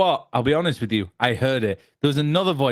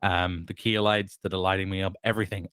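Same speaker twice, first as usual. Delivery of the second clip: ordinary delivery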